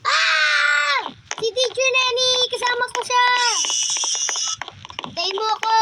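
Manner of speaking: high, loud scared cry; panicked screaming. Real, not acted. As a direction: scream as you run away. Fleeing teacher in game.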